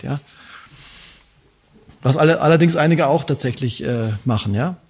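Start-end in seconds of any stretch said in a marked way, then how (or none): none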